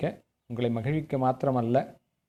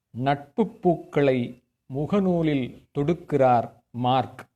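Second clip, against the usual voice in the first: slow, raised, ringing stage-poet delivery; poem recitation; 5 semitones higher, 6 dB louder than usual. Acted. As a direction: declaim each phrase loudly and slowly, with pauses between. Recites own poem at poetry gathering.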